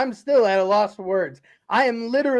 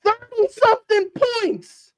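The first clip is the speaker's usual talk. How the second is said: high incredulous repeat of "Thirty-something points"; disbelief; 11 semitones higher than usual. Real, not acted. Host repeating the score in disbelief. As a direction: say "Thirty-something points" like you can't believe it.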